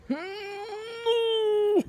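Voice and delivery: long, very high held whine; mock pained squeal. Woman getting a contraction during the epidural, mock pained squeal. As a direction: hold a high closed-mouth squeal.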